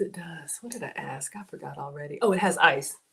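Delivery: usual speaking voice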